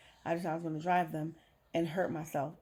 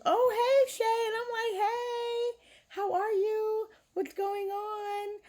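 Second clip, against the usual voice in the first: high, bright put-on happy voice masking pain; fake cheerful greeting; 5 dB louder, slower than usual. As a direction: sing it out sweetly, like nothing's wrong. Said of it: "every time anyone saw me, it was".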